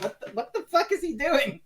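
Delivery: high-pitched, baffled retold reaction; mock bewilderment. Listeners puzzled by smacking sounds from another room, acted bewilderment. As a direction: act out puzzled disbelief. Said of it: "and it's like".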